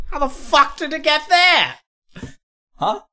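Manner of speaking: high, shouted mock demand; mock interrogation shout.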